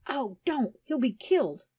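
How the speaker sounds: high, agonized protest; acted alarm, high-pitched plea. A narrator's reading. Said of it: "expostulated in an agonized voice".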